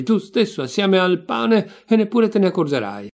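heated comeback, pitched high; angry retort. Acted. Insult flung back in acted quarrel.

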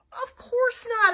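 high, loud, drawn-out yell. A narrator's reading. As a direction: yell it in protest. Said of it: "I yelled".

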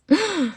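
startled gasp; quick shocked gasp; sharp high gasp. Acted. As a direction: gasp at the tickle. Itch reaction.

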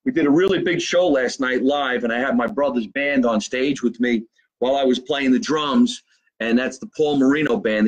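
usual speaking voice

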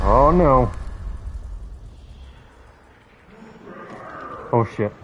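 panicked yelling while being chased; frantic scared shouts, voice jumping high. Real, not acted. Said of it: "I'm being chased right now".

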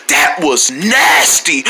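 slow, low, emphatic play disgust; mock disgust. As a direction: drag it out in pretend revulsion. Acted disgust, repeated emphatically.

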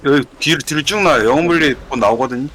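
loud urgent raid call; calling out loudly. Real, not acted. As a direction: call it out over the fight. Raid leader calling out to party.

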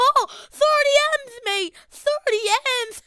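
loud, high, thrilled yell; crowing over the loot pile; excited shout. Real, not acted.

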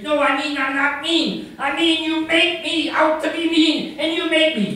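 high, loud, flustered protest; protesting peace freak. Acted. Peace freak denying being mean, high heated protest. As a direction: argue back high and loud.

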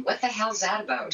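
ordinary talk, nothing marked

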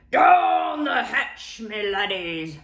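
pirate yell. Acted. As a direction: pound the table and roar it. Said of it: "pounded his fist on the table and yelled".

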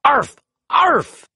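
high, loud barking arf; walrus bark. A narrator's reading.

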